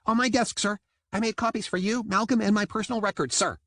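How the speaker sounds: high, crisp dutiful report; eager dolphin soldier. A narrator's reading. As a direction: rattle it off like a keen cadet. Jam reporting to captain, high.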